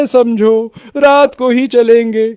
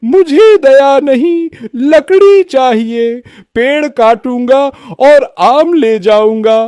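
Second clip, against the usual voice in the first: merciless woodcutter; 3 semitones higher than usual. Acted. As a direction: refuse the plea with greedy menace. Woodcutter refuses mercy. (निर्दयी लकड़हारे की आवाज़)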